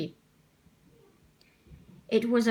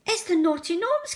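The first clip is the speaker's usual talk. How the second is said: high, terrified cry; scared cry; 8 semitones higher than usual. Acted. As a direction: cry out at the spilled gnomes. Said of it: "cried Percy".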